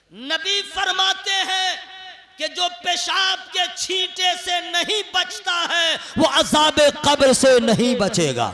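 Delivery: very loud, ringing declamation; full-force preaching. Acted. Preacher delivering the Prophet's warning, full-force preaching. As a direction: proclaim the warning to the whole crowd.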